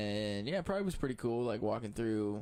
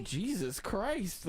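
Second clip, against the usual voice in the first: exasperated; 10 semitones higher, much slower than usual. Retold or imitated. Exasperated retold reaction.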